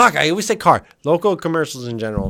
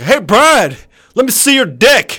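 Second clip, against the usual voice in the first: higher, louder cheeky call; mock customer yell; 8 semitones higher, 5 dB louder, slower than usual. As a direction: barge in calling out loudly. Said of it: "imagine you walked in like".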